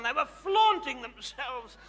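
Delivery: ordinary talk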